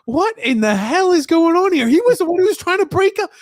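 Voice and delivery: high, loud stunned disbelief. Real, not acted. Fan shocked the peacekeeper threw the punch, high and loud. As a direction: cry out in shocked amazement.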